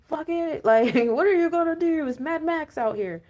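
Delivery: high, loud mock tough talk; acted-out harsh telling-off, pitched right up; blunt mock scolding. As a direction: lay down the law loudly, half laughing. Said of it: "some people you gotta just be like".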